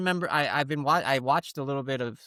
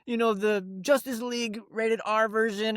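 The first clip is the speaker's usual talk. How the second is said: protesting son; 8 semitones higher than usual. Retold or imitated. Son argues with dad over R rating, high protesting voice. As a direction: push back like a refused kid, pitched way up.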